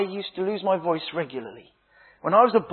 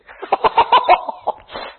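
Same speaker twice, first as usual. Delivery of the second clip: laughing; 13 semitones higher, 6 dB louder than usual. Real, not acted. Laughs at his own naughty joke.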